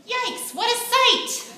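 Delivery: high, bright-eyed gasp of dismay; high startled exclamation; excited exclaim. A narrator's reading. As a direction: cry out in shocked disgust. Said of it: "she exclaimed".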